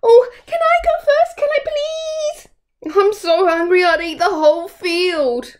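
high, pleading little goat voice; a small goat begging to go first; eager little goat. Acted.

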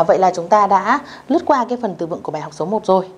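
normal talking voice

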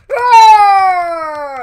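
loud high scream through voice changer; distorted scream. Real, not acted. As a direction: yell through a weird voice effect. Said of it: "That sounds so weird".

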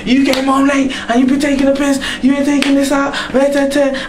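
high, mocking nagging voice; nagging girlfriend. Girlfriend nagging him at home, in a high mocking voice. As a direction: rattle off her complaints in a high mock nag. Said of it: "them ones that talking".

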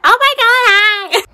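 a dark lord who sounds comically cheerful; silly Voldemort voice. Retold or imitated. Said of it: "He talks and is".

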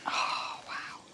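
awed whisper. Real, not acted. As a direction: breathe it in wonder. Sloth handed over, awed.

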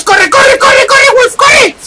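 very high frantic squeal; panicked game shouting. Real, not acted. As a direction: yelp it over and over, pitch soaring. Panicked flight from enemies in game.

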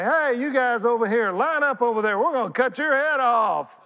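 loud, high, hammed-up threat; play-acted shouting at the brothers; mock executioner shout. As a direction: bark orders like a gleeful executioner. Acting out Joseph's axe threat.

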